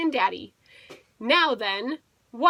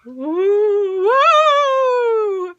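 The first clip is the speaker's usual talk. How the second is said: high, loud rising ghost howl; bed howling like a ghost, long and high; ghostly bed howl; 10 semitones higher, 8 dB louder than usual. A narrator's reading. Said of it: "howled Boris the bed".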